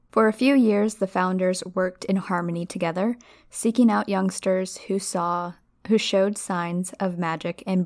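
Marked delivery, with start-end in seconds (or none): none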